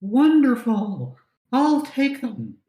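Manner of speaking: high, slow gracious lady voice; high elf lady voice. Acted. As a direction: accept graciously like a noble.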